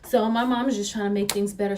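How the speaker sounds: ordinary delivery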